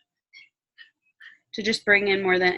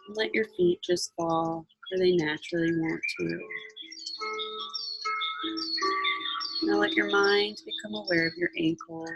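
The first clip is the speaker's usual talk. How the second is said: guided meditation; 4 dB quieter than usual. Acted.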